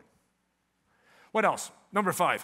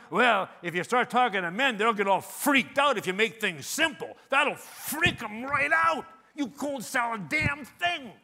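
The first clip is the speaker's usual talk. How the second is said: loud, building protest, drawn out at the end; hammed-up indignant shouting, climbing higher; mock outraged objector; 5 semitones higher, 9 dB louder than usual. Speaker mimics a sceptic of Arwen's advice, mock outrage.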